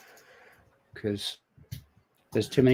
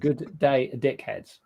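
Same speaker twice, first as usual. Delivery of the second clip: put-on posh Australian accent; 5 dB quieter, much slower than usual. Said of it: "[name]'s doing a rather posh Australian accent".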